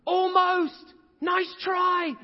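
high, loud encouraging cheer; cheering mom. Retold or imitated. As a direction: call out like a proud mom in the stands.

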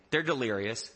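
normal talking voice

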